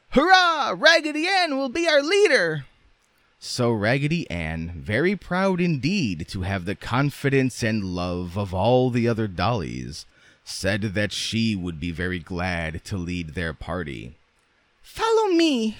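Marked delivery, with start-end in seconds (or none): 0.1-2.6 loud, very high squeaky cheer; shouted doll cheer. A narrator's reading. Dolls cheering their new leader, shouted. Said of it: "clapped their hands together and shouted". 14.8-15.7 loud, very high squeaky call; doll crying out. A narrator's reading. Raggedy Ann leading the dolls off, crying out. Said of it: "she cried".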